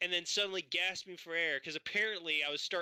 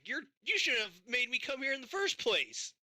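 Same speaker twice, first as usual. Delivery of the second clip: retold angry retort; 7 semitones higher than usual. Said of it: "I was like".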